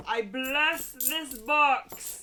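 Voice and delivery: slow, loud blessing; solemn blessing. Pretend. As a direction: bless it slowly like a ritual.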